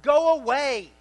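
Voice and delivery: high, loud go away shouted; Jesus ordering the mourners away, loud; loud command. Retold or imitated. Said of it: "Jesus says to the mourners".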